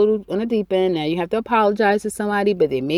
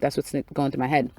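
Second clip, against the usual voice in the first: ordinary talk, nothing marked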